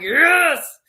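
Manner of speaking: loud, high victory cry; author's triumph; triumphant yes. Retold or imitated. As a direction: celebrate the win. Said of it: "I'm like".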